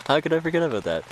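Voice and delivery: can't believe he forgot it; amused disbelief. Real, not acted. Realising he forgot the condom story, amused disbelief. Exclaims at his own forgetting.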